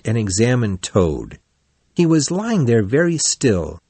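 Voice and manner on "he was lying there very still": ordinary delivery, nothing marked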